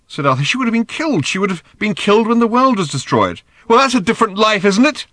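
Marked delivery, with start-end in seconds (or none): 0.4-3.4 high-pitched, insistent argument; agitated protest. A narrator's reading. Father objects about her mother's fate, agitated. Father arguing back in quarrel. 3.7-5.1 high, loud comeback; angry retort. A narrator's reading. Daughter answering her father in a row, angrily.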